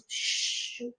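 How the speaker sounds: soft, long hiss for quiet; drawn-out shh; silencing shush. Shown as a demonstration. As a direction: shush the room. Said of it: "de silêncio".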